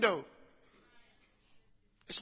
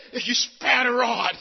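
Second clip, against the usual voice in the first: preaching at full force; 9 dB louder than usual. Acted. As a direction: drive the verse home to the congregation.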